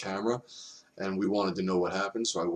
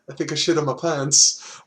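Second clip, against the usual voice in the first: Italian biker voice; 7 semitones higher, 6 dB louder than usual. Retold or imitated. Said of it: "He spoke broken English".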